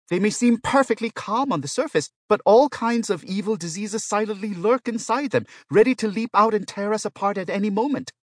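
very high, loud, worked-up pleading; alarmed protest. A narrator's reading. Patient warns the robot about the other patients, alarmed protest. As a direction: protest in mounting paranoid alarm. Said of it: "Yang Wei protested".

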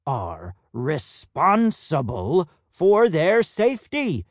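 slow, stern, drawn out. Acted. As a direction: spell it out slowly. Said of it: "said Hampstead slowly".

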